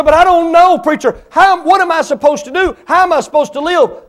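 high, anxious pleading voice; a doubting churchgoer begging the preacher for answers. Pretend. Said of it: "You say".

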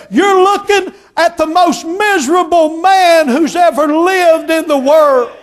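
loud high anguished confession, slowing at the end; anguished cry. Retold or imitated. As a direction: cry it out in misery. Miserable man confesses, stood up.

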